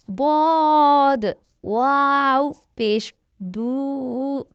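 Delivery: slow, stretched drill voice, each syllable held long; letter by letter, drawn out and distinct; slow spelling recitation. Shown as a demonstration. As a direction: spell it out slowly for a child learning to read.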